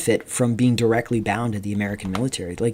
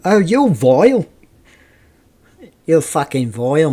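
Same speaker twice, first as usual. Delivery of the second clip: put-on British chav accent; 5 semitones higher, 4 dB louder than usual. Imitating English chavs using the word vile, in a mock British accent. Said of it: "chavs will use it".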